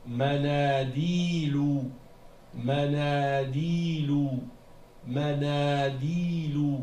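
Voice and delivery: Manadilu drawn out slow and loud, long "naa" and long "dii"; long alif and ya. Shown as a demonstration. Teacher models a word with two long vowels, Manadilu held slowly. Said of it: "il faut prononcer le alif et le ya".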